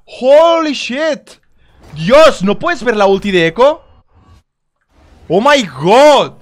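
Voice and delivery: loud high angry rant; raging gamer. Real, not acted. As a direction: vent at the team, shouting. Keeps raging at team.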